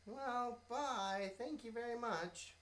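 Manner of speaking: high, slow, polite little bee. Acted. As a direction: ask sweetly, stretching excuse. Bee leaves hastily.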